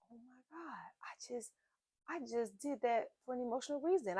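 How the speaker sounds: a soft, stunned whisper to herself; hushed realization, barely audible. Retold or imitated. Stunned self-realization in kitchen.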